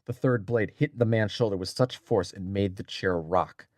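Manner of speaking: plain reading voice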